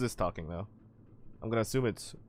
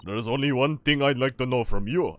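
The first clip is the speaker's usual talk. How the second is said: loud Russian interrogator; Russian accent; 6 dB louder than usual. Acted. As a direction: question the prisoner in a Russian accent. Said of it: "it's the Russian, other Russian sailor".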